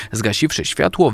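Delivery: ordinary delivery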